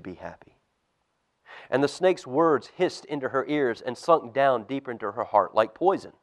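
put-on lively storybook reading for kids; reading to children. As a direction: tell it like a bedtime Bible story.

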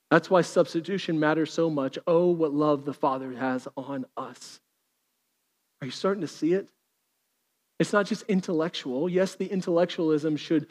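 hushed intimate preaching. Acted. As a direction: preach it gently, almost confiding. Quiet intimate preaching passage.

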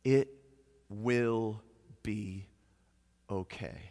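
soft, low, slow reassurance; gentle but firm. Acted. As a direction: say it like a gentle promise. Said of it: "gentle but firm reminder of our God".

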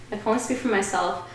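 ordinary talk

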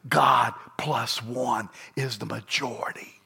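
soft whisper of the written words. Acted. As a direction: hush it like a tender secret. Quoting his note to grandson.

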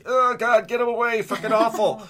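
high-pitched mock horror, pushing it away; retold revulsion. Podcast guest reliving first sight of the sugar cube, revolted. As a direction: recoil in exaggerated disgust, pitched well up.

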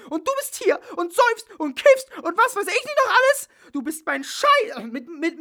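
very high, loud furious screaming; furious shouting at her ex and Dracula; furious yelling. Acted. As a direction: scream at him until your voice cracks.

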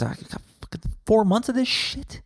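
high, incredulous squeal of realization; mock dismay. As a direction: act out dawning horror at the long winter. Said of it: "And then you realize".

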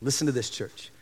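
usual speaking voice

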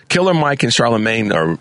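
usual speaking voice

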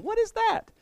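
high, amazed squeak; surprised customer. Pretend. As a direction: gasp out the question in wonder. Customer asking in dialogue.

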